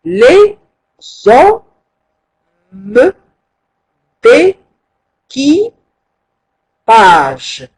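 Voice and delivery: d'équipage drawn out very slowly; teacher rereading the verse in syllables; slow syllabified reading. Shown as a demonstration. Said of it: "إذا".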